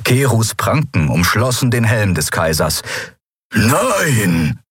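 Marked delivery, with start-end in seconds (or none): 3.5-4.6 resisting. Acted. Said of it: "wehrte sich dieser".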